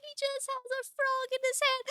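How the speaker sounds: very high, tearful squeak; real high-pitched, choked-up adoration; overcome with cuteness. Real, not acted. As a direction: squeak it out as if crying with delight.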